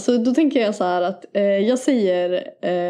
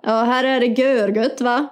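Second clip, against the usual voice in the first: playful Gothenburg dialect; Gothenburg accent. Acted. Answering the Gothenburg girl, Gothenburg accent. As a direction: imitate a Gothenburger. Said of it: "Göteborg-tjej".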